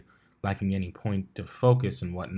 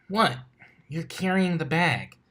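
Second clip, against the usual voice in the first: higher, mock-innocent shrug of a voice; innocent Dash; 7 semitones higher than usual. A narrator's reading. Dash rides on Blitz's back, feigning innocence, pitched up. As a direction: play innocent, a touch high.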